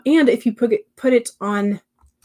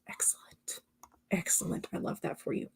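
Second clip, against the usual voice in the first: soft whispered praise; 15 dB quieter than usual. Real, not acted. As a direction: breathe it out quietly. Whispered repetition.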